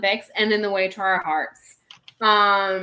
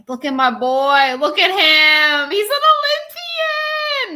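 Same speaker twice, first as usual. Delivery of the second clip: overjoyed owner squealing at the screen; gushing; 8 semitones higher, 9 dB louder than usual. Real, not acted. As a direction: gush over the dog on screen. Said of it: "gushing over dog on screen".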